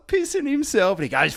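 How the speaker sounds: usual speaking voice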